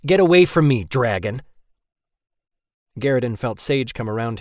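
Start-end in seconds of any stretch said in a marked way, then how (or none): 0.0-1.4 loud high yell, then drop; angry yell. Acted. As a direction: yell him away in fury. Rory yelling at Kerwin.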